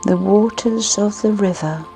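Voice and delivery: ordinary delivery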